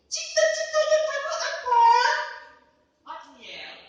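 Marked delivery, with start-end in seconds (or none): 0.1-1.8 teacher asking. Retold or imitated. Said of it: "guru suka bertanya".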